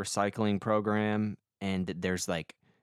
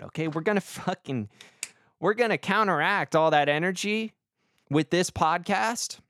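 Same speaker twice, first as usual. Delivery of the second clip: usual speaking voice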